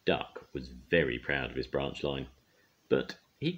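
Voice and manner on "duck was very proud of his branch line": usual speaking voice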